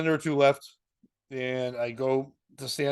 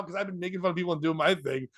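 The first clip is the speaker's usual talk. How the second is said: ordinary delivery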